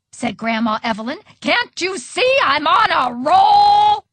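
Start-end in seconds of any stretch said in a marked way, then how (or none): 1.4-4.0 high, loud and drawn out; exasperated shout. A narrator's reading. Grandma to waitress mid-game, exasperated drawn-out shout. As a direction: holler it, dragging out the last word.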